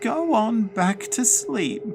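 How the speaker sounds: high, slow commanding ghost-granny voice; ghostly order. Acted. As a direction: order them off like a bossy dead grandmother. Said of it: "Granny Diva ordered".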